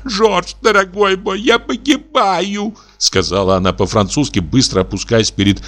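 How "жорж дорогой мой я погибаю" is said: high, slow, sobbing cry; высокий рыдающий голос; sobbing plea. A narrator's reading. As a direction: break down weeping as she begs. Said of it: "но вдруг зарыдала".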